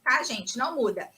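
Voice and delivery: ordinary delivery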